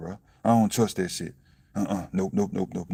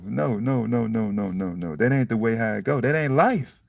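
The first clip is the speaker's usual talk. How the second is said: normal talking voice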